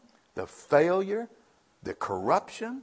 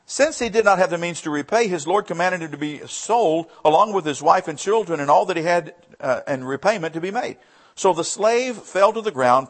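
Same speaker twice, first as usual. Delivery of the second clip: raised, driving Bible reading; scripture reading; 4 semitones higher, 5 dB louder than usual. Acted. Preacher reading the parable to the congregation, raised and driving. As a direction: read the verse out to the church.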